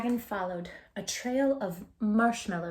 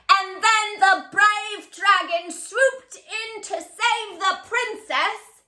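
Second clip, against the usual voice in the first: higher, louder and slow heroic storytelling; boy reading aloud big and slow to lure the dragon; booming hero tale; 8 semitones higher, 6 dB louder, slower than usual. Acted. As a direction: proclaim it like a grand storyteller. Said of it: "read aloud in a booming voice".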